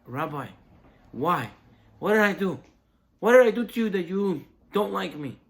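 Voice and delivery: loud, high, wounded protest; hurt angry outcry. Retold or imitated. Host to the rabbi at the back door, loud hurt outcry. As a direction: demand an answer, voice climbing.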